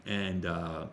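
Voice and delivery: ordinary talk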